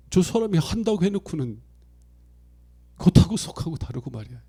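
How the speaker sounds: griping that he said yes but didn't; outsider complaint. Retold or imitated. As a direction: outsider scolding the Chungcheong man. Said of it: "충청도 사람이 아닌 사람들은".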